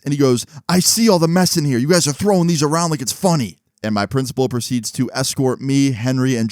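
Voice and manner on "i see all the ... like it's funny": higher-pitched, indignant mock telling-off; principal's scolding. Principal retold scolding the boys.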